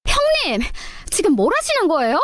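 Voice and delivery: a sharp, offended question to the elder sister-in-law; sister-in-law's shrill retort. Acted.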